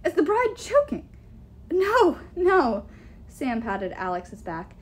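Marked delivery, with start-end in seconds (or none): 0.1-1.1 high, loud alarmed question; alarmed demand. A narrator's reading. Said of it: "the giantess demanded". 1.7-2.9 high, loud quick reassurance; hasty denial. Acted. Sam hastily covers for Alex.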